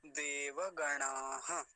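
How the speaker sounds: देवगणाः slowly, va full, ha at the end; visarga sounded. Shown as a demonstration. Teacher recapping the whole word, slow and syllable by syllable. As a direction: stretch each syllable of देवगणाः. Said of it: "पूरा शब्द क्या हो गया".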